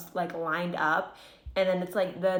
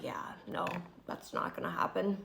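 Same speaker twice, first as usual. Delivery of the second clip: hushed flat refusal; 8 dB quieter than usual. Retold or imitated. Retold refusal, quiet.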